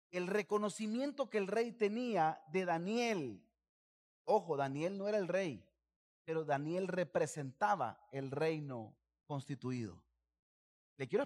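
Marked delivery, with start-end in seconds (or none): none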